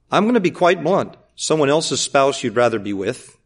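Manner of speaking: normal talking voice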